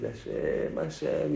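whispered, breaking call to God; tearful plea. Retold or imitated. Noah weeps after the flood, hushed tearful calling. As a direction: call his name through quiet tears. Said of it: "empezó a llorar".

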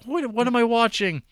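high, loud incredulous outburst; mock bafflement. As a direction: play the baffled viewer, way up high. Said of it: "it's like".

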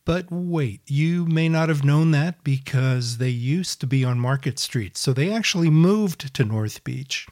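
loud, bright announcer-style podcast intro; podcast intro read. Acted. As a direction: read it like a radio show opener.

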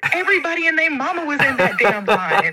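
high, amused rant, laughing near the end; excited. Real, not acted. Guest griping to the host about the crowded line, high and amused. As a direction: pile it on with a laugh.

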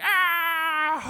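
high, loud mock scream of frustration; frustrated scream. Parent screaming inwardly at kids tearing wallpaper, mock scream. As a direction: let out an exasperated shriek.

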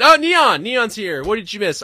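high, loud happy welcome; light up as a friend joins; excited greeting. Real, not acted. Streamer loudly greeting viewer Neon arriving in chat, excited.

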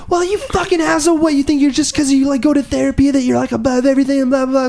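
very high-pitched mock tirade; hammed-up shrieky rant; imitated outraged ex. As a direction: mimic her indignant blow-up in a squeaky high voice. Imitates ex's angry retort.

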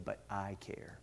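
very soft, low, heartfelt reply; quietly earnest. Retold or imitated. Bride to pastor about her groom, quietly earnest. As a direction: imitate a hushed, earnest protest.

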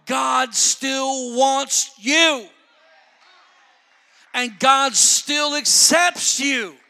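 loud, very slow, high preacher's cry; preaching. Real, not acted. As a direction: pound each word to the congregation. Preaching at full force.

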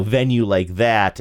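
ordinary delivery

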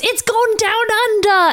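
slow, high, hammed-up catchphrase; Pyro. Pyro as the spider burrows underground, hammed-up shout. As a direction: stretch it out high like a cheesy one-liner. Acts out Pyro's cheesy line.

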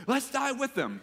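high, cheery rallying cry; mock-rousing slogan. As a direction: sell it like a slogan.

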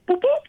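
high imitated alarm beeps; alarm noise. Fighter sensing a bad deal, high imitated alarm beeps. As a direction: beep like an alarm going off. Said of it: "Like my, my alarm's going off".

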